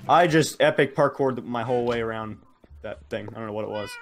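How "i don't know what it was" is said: usual speaking voice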